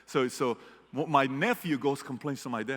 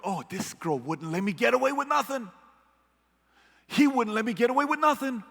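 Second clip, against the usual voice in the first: rising, louder, worked-up telling; excited retelling; 9 semitones higher, 3 dB louder than usual. Retold or imitated. Employee at meeting telling boss story, excited. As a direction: gush about the tough boss.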